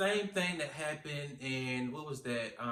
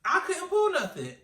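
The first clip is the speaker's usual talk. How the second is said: very high, loud squeal of exasperation; exasperated retelling; 8 dB louder than usual. Retold or imitated. Telling viewers about failed note-taking, high exasperated squeal.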